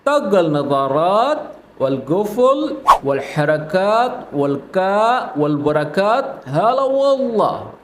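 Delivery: slow, careful Arabic phrase; slowed-down Saudi phrase; slow repeat. Shown as a demonstration. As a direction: spell it out slowly for learners. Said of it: "दोबारा से सुन लें".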